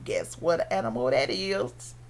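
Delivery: usual speaking voice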